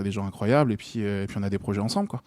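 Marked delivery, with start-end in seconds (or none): none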